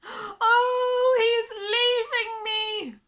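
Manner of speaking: high, loud shriek. Acted. Miss Lark watching her dog depart, shrieking. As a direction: shriek it in despair. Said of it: "shrieked Miss Lark".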